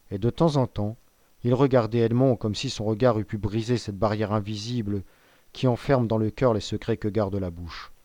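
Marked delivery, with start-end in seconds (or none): none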